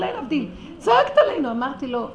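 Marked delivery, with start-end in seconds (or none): 0.8-1.5 high, loud accusing cry; retold yell. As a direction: hurl the accusation back at her. Repeating his accusing outburst.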